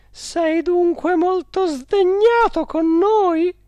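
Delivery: high, slow, loud woman's plea; coaxing directress. A narrator's reading. Directress asking if the spirit is angry, high pleading. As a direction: draw out the question in a high voice. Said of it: "aggiunse ella".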